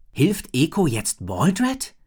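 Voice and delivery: boy voicing his worry; worried boy. A narrator's reading. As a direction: puzzle it out, slowly. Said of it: "fragte sich Drake laut".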